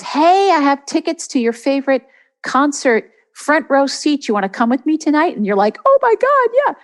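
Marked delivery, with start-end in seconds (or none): 0.0-5.4 higher-pitched, eager invitation; peppy imitated phone invite; excited friend calling. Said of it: "your friend calls you and says". 5.9-6.7 high-pitched, loud mock squeal of excitement; imitated gushing yes; suddenly energised. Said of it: "suddenly you have the energy to go".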